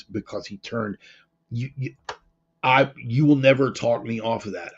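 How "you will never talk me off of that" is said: ordinary talk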